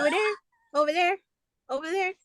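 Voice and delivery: "Over there" with a rising tune; teasing over there. Shown as a demonstration. Teasing friend by repeating the proper form. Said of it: "Or why do I have to say".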